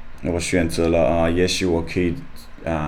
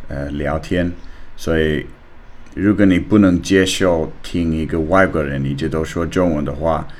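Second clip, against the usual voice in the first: Australian learner's Mandarin accent; foreigner speaking mandarin. Real, not acted.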